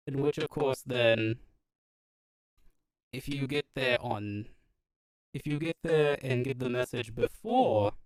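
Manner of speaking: robot NPC through a voice changer. Acted. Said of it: "I'm gonna stop using the voice changer".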